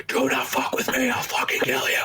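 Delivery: high, strained put-on threat; a hammed-up tough-guy threat; mock cop threat. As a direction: cop pinning Clarence in the elevator, mock menace. Cop threatening Clarence in elevator.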